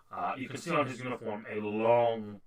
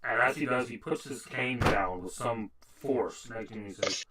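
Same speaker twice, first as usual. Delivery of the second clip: usual speaking voice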